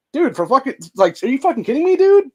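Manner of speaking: high and loud, building to a yelp; outraged disbelief. Real, not acted. Ranting at fast food prices, loud outraged disbelief. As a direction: explode at the price like it's a joke.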